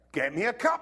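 put-on loud, bossy little-kid order; demanding four-year-old. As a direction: play the cheeky kid bossing his dad. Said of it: "four-year-old said".

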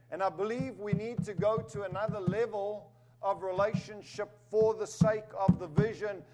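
a sermon building to full force; preaching. Acted. Preacher urging the church toward another level, rising. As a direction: lift the church toward a bigger vision.

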